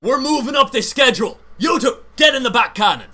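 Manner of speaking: high, loud captain command; shouted orders. Acted. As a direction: bark orders at the crew. Captain gives crew orders.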